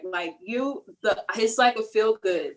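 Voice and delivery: normal talking voice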